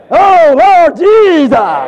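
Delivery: loud, high, drawn-out devotional shout; shouted cry. Acted. Preacher showing believers calling upon the Lord, shouting. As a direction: cry it out with full emotion. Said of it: "You will shout".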